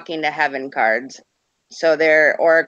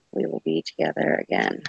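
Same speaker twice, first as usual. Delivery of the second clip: whispered; 7 dB quieter than usual. Real, not acted. Reads card softly.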